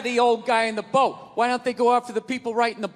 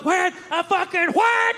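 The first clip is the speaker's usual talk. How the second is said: mock duck call; 7 semitones higher, slower than usual. Imitating duck whistle call.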